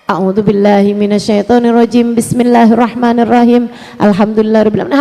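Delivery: low, reverent recitation; recited in Arabic. Shown as a demonstration. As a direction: intone the opening of the prayer. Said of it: "Kamu harus bacanya".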